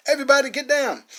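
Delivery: high, loud yell for cover; urgent shout. Acted. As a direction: bellow the order as the bomb flies. Dynamite thrown into church.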